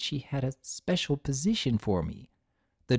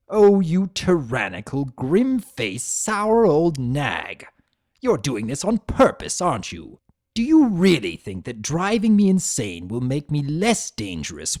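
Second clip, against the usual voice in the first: loud, scornful insults, slowing on the threats; furious, sneering villain; angry tirade; 5 dB louder than usual. Acted. Discord hurling insults at Celestia, loud and bitter.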